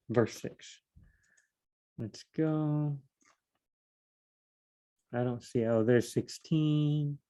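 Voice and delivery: soft, absent-minded murmur while searching; half to yourself, hunting for a verse; talking to oneself. Real, not acted. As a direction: think aloud under the breath. Searching the text, talking to self.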